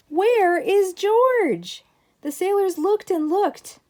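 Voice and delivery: high, loud, slow anxious call; alarmed calling. Acted. Sailors searching the ship, calling loudly in alarm. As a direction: call out across the deck, worried.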